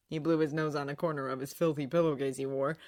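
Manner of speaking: ordinary delivery, nothing marked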